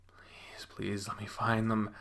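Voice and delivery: soft, pleading whisper; whispering to oneself. Acted. Narrator gropes for dropped coins, whispered plea to self. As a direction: beg under your breath, almost inaudible. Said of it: "I whisper to myself".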